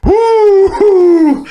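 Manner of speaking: high, loud nervous woo; mock-panicked whooping exhale; panicky woo. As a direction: let out high, drawn-out woos as if panicking. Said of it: "a little mini panic, panic attack".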